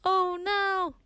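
high, hammed-up horror; mock dismay. Host playing out the zero on the exam, high mock dismay. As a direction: cry out in playful alarm.

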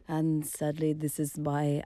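imitating the German host's accent badly. Host imitating departing German colleague, weak German accent. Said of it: "I can't do a German accent".

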